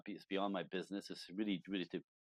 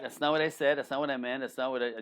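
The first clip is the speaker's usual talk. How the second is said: loud, higher, insistent protest; protesting man; 5 semitones higher, 8 dB louder than usual. Acted. Man to woman who took it personally, loud protest. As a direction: act out a man insisting he's misunderstood.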